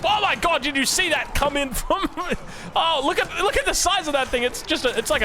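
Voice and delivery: high-pitched burst of amazement with a laugh; astonished excitement. Real, not acted. Caster seeing a huge rocket come in, astonished. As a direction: react in real disbelief, voice shooting up.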